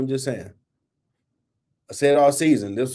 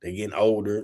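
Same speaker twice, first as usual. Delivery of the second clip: lower, much softer sneering mock voice; mocking sucker critics; 6 semitones lower, 6 dB quieter than usual. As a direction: mimic the cowardly critics under your breath. That's sucker stuff.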